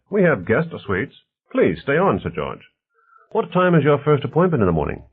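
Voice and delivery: lively, mildly voiced character dialogue; affable host Bonner. Bonner talks politics with his guests, lightly voiced affable host.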